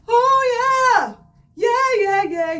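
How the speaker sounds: high, balanced chest mix; light chest voice. Shown as a demonstration. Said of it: "I wasn't tight and tense".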